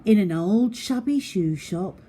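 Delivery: ordinary delivery, nothing marked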